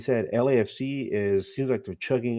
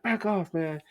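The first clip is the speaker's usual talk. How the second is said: higher-pitched mock snub; mock hostile brush-off; 7 semitones higher, 3 dB quieter than usual. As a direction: act out an unfriendly fan shooing someone away. Imagined hostile supporters snub visitors.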